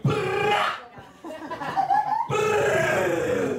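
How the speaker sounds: high loud mock gunfire burst; gun noise. Comic mocking rap songs' gun noises, loud and high. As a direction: spit out a rapper's gun-noise ad-lib. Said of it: "it'll be like".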